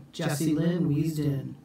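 plain reading voice

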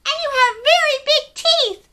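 high, loud curious little penguin voice; a tiny penguin full of questions. Acted. Story reader voicing small penguin Pipkin asking big questions. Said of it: "said Pipkin".

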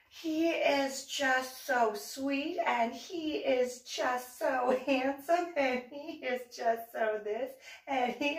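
high, slow dreamy gushing; swooning neighbor. Retold or imitated. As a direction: melt over a crush. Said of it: "But she".

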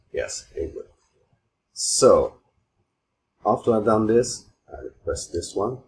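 ordinary delivery

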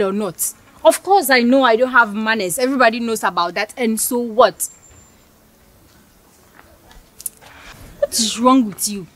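put-on a haughty girl furious at being called ill-mannered; offended outburst. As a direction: tear into him for insulting you. Heated quarrel, offended girl.

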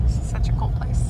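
hushed, breathy whisper. Real, not acted. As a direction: whisper it in awe. Vlogger admiring the mall.

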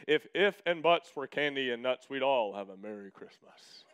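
mock booming voice of the old coach, dropping low at the end; imitating the coach's big booming voice, far from his own. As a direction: boom it out like a gruff coach laying down the law. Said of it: "big booming sounding voice like this".

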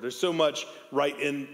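ordinary delivery

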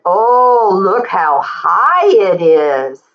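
ordinary delivery

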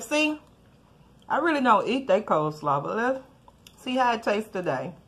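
talking with food in mouth; mid-bite tasting; chewing while talking. Real, not acted. Seen 0: Spoonful taken at -1.0; she is chewing and grimacing while talking.

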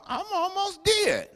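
high, slow, drawn-out joking moan; mock dying wail. Preacher joking about his long sermon, high and slow. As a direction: stretch it up high like he's about to expire. Joking slip before correcting himself.

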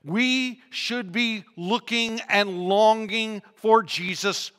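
each phrase drawn out with weight; emphatic preaching. Real, not acted. Preacher urging people to long for Jesus' return, slow and emphatic.

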